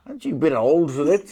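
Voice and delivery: high, loud mock disbelief; teasing. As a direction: poke fun at an old man marrying. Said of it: "and my kids say".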